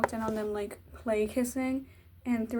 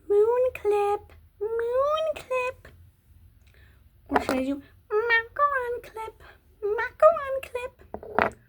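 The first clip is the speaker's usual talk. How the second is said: very high, cutesy singsong; silly high-pitched voice; 11 semitones higher, 5 dB louder, slower than usual. Pretend. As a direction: play with the clip names in a high, drawn-out squeak. Silly squeal while picking a hair clip.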